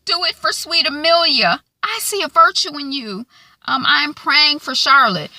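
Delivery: high, loud mock-dramatic plea; a hint of a theatrical villainess; Margaret quote. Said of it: "she says".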